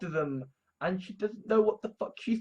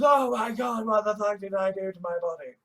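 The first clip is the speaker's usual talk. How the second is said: agonised outcry. Retold or imitated. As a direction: cry out as if something just went badly wrong in your body. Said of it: "keel over in pain and agony".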